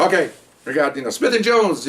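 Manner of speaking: high, sing-out roll call; sergeant calling roll. Retold or imitated.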